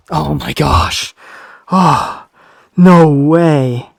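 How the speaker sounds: loud, drawn-out disbelief; exasperated shout. Real, not acted. Player has just died.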